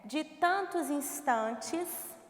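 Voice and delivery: slow, measured line of verse; "de tantos instantes" in full, unhurried; slow verse reading. Shown as a demonstration. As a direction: read "de tantos instantes" slowly, every word complete. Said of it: "Então, observem".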